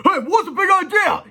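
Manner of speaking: very high, loud angry yell; high yelled protest. Acted. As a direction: yell at the intruder. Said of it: "yelled Mister Sneedley".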